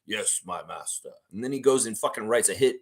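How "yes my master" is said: softer, lower obedient reply; a hint of Vader bowing to his master; mock Darth Vader. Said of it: "Michael Grange is Darth Vader".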